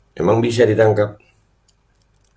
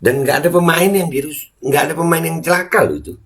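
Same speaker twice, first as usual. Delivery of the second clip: high, mocking quote voice; mocking official; 10 semitones higher, 3 dB louder than usual. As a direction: parody the police spokesman. Mocking police official's claim.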